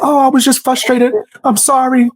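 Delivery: high-pitched imitation of a backpedalling apology; mock apology. Retold backpedalling apology from panicking streamer.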